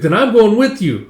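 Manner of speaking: put-on high, loud insistent kid voice; eager child. Child demanding to join the voyage, eager. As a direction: insist eagerly on tagging along.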